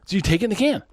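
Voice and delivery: high, quick comic outburst; mock yelling. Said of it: "Stop yelling".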